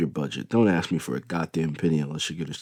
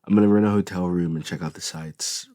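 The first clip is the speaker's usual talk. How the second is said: ordinary delivery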